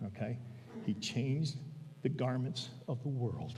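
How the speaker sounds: ordinary talk, nothing marked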